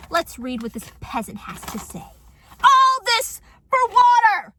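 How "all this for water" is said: high, loud, drawn-out cry of disbelief; outraged shout. Acted. Vlogger reacting to a neighbor's note, outraged shout. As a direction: yell it slowly, theatrically aghast.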